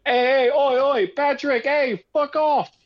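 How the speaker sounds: high, loud, hammed-up bad British accent heckle. Mocking a teen fan heckling Patrick off the pitch, in a bad British accent. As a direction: jeer like a lout in an awful British accent. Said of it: "that was a horrible British accent".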